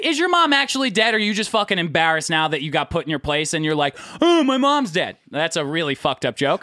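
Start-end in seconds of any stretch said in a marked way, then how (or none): 4.2-5.2 high mock-wounded protest; hammed-up hurt, pitched up; whiny heckler. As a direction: imitate a heckler playing the victim. Mimics an embarrassed heckler's excuse.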